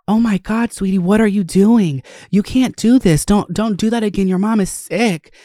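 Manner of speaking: put-on high, urgent pleading with a small child; frantic scolding child. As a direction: scold the toddler in a rush of panic. Snatching child from road.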